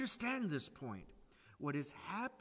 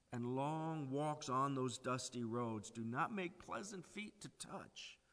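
ordinary talk

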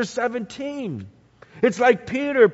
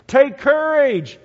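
louder, higher and slow call over the storm; Jesus calling to the boat in the storm; 6 semitones higher, 6 dB louder, slower than usual. Acted. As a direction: call Take courage across the waves.

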